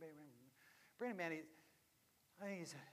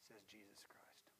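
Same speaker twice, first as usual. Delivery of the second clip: softly whispered, slow; 9 semitones lower, 21 dB quieter than usual. Real, not acted. Preacher whispering to the congregation who said it. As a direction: drop to a hush after the climax. Hushed tag after the climax.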